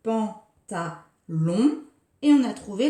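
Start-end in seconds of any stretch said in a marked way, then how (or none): 0.0-1.8 pantalon drawn out slowly beat by beat; slow syllables. Shown as a demonstration. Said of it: "la syllabe long". (pantalon très lent et scandé)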